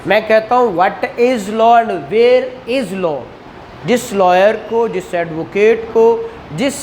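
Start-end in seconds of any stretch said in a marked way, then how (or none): none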